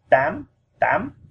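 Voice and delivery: "tam" swept sharply upward in pitch; rising tone. Shown as a demonstration. Teacher demonstrating Vietnamese eight, "tam" with rising tone. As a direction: send the voice up through "tam". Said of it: "goes up".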